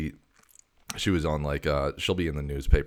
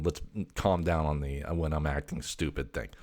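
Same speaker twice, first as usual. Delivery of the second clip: ordinary talk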